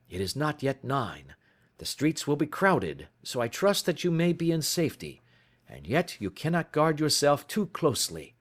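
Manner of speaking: detective pressing for evidence; Holmes urgent. Acted. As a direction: urge him on, impatient. Holmes's dialogue voice.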